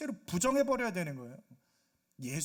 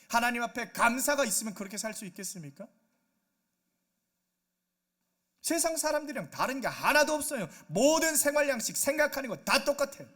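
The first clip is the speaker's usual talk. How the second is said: louder, higher, impassioned sermon delivery; forceful preaching; 4 semitones higher, 6 dB louder than usual. Real, not acted. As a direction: drive the rebuke home to the congregation. (목소리를 높여 질타하는 설교)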